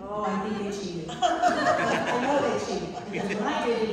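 laughing loudly; cheated said through laughter. Real, not acted. Teacher laughing at children.

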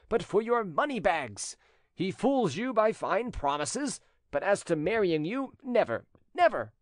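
high-pitched, emphatic protest; indignant rejected suitor. A narrator's reading. Jilted cousin denouncing his rival to the widow, indignant. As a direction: protest hotly, hammering the accusations.

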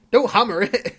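loud, high warning yell; shouting at sim. Real, not acted. Yelling at his sim not to hammer it, loud alarmed shout. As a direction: shout to stop him in time.

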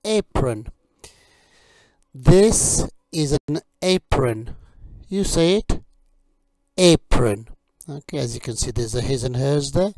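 slow, loud, carefully clear teacher drill; vocabulary drill. Acted. Teacher drilling kitchen words for learners, slow and clear. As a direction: model each word for learners to repeat.

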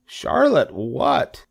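high, drawn-out piggy question; eager question. A narrator's reading. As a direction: ask with lightly acted eagerness. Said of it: "asked Wilbur eagerly".

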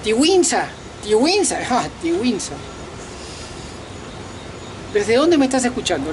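usual speaking voice

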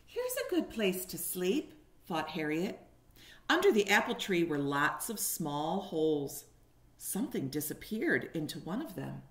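put-on lively storybook reading for little kids; reading to children. A narrator's reading. Teacher reading a picture book to kindergartners, animated. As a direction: paint each moment of the story for the class.

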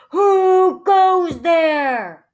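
very loud, stretched-out troll demand; high, loud, drawn-out challenge; grumbling troll. A narrator's reading. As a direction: bellow it slowly as a hungry troll. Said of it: "grumbled the hungry troll".

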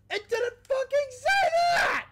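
very high, loud outraged yell; furious shout. Real, not acted. Player furious at the game, very high furious shout. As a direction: scream it at the game. Game didn't warn him.

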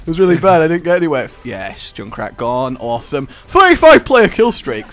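normal talking voice

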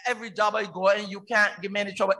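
ordinary delivery

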